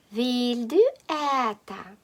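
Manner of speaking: ordinary talk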